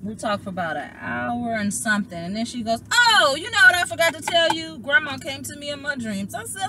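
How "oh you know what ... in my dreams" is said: high, loud burst of news; imitating an excited sister on the phone. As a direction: blurt out remembered news. Sister's sudden news acted out.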